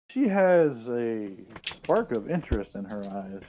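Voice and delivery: ordinary talk, nothing marked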